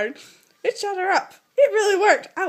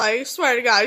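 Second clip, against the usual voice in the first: ordinary talk, nothing marked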